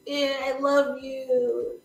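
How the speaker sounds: slow, drawn-out adoring fan; mock lovestruck moan, held back; Anna. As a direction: stretch it out like a swooning superfan. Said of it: "this is where Anna becomes like".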